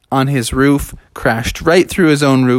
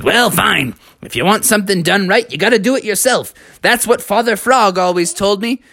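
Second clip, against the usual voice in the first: slightly higher, quick frog retort; lightly voiced frog taking charge; huffy little speech from a frog; exasperated frog; 6 semitones higher than usual.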